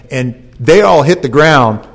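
ordinary delivery

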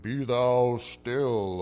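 slow, grand King's English proclamation; majestic movie Jesus. Acted. Imagined movie Jesus commanding the storm, mock-majestic. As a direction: draw it out like a solemn film Messiah. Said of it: "standing up in the King's English".

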